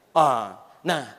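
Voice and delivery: usual speaking voice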